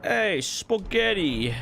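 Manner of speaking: slow, drawn-out wiseguy "Hey, spaghetti"; mock Italian mobster drawl; mobster accent. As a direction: stretch it like a cartoon Italian mobster. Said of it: "how a mobster talks".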